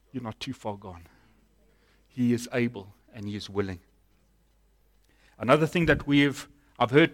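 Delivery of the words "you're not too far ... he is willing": low, soft and reassuring; tender reassurance. Real, not acted. Preacher answers the listener who feels too lost, tender reassurance. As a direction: gently comfort someone who has given up.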